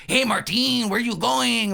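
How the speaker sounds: hammed-up Speedy Gonzalez style accent; mock Mexican accent. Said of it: "like, a Mexican accent".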